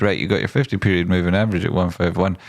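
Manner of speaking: ordinary talk, nothing marked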